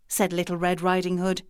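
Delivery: plain reading voice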